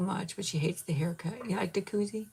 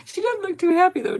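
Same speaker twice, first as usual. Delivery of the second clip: much higher and louder, amused; excited high exclaim; 13 semitones higher, 10 dB louder than usual. Real, not acted. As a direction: tease that she looks unhappy.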